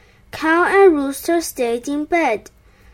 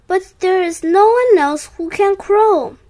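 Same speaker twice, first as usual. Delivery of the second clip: higher, louder plaintive protest; moaning; 6 semitones higher, 5 dB louder than usual. A narrator's reading. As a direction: moan it as a worried objection. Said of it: "moaned Rooster".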